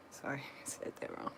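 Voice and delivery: quick breathy laugh through the apology; embarrassed giggly aside; laughing at herself. Real, not acted. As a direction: laugh off the slip, hushed and fast. Flubs a line, laughs it off.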